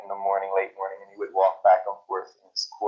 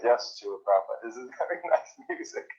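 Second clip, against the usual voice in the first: laughing agreement; 3 semitones higher, 4 dB quieter than usual. Retold or imitated. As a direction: imitate eager agreement, pitch climbing. Acted eager agreement, laughing at self.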